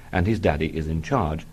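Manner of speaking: normal talking voice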